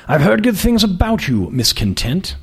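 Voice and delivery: put-on upper-class prep school and British accent; lofty aristocrat sizing up a young woman; aristocratic accent. Magazine boss greeting a job candidate, aristocratic accent. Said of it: "His accent was patently aristocratic".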